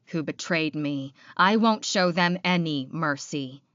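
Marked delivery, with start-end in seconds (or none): none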